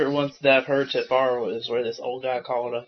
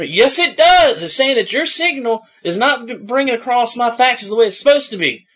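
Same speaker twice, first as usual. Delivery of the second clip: indignant caller; 7 semitones higher, 7 dB louder than usual. Retold or imitated. Acted argument with caller.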